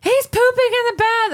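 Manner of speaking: put-on high squealing little girl; child voice. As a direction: tattle like a shocked kid. Child tattling to mom.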